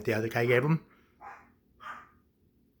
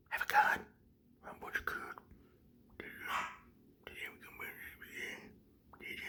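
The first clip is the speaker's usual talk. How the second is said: barely audible whisper; whispered interrogation; 14 dB quieter, much faster than usual. Acted. Boss questioning clown about a gun, fading whisper. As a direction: repeat it under your breath, fading to almost nothing.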